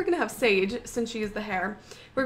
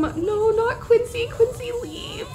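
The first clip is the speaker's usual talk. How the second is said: alarmed plea; 8 semitones higher, 4 dB louder, slower than usual. Real, not acted. Pleads for pet to escape.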